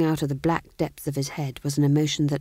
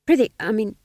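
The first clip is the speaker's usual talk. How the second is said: higher, eager jester patter; jester fool; 5 semitones higher, 6 dB louder than usual. Acted. Fool replying to the Duke in jester talk, higher. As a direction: chirp it like a court fool.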